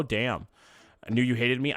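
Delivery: ordinary talk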